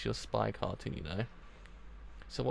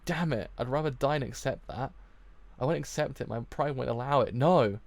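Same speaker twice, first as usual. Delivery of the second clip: raised, vehement proud protest; indignant refusal; 6 dB louder than usual. Acted. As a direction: refuse loudly, building to an outburst. Proud boy refuses in outrage.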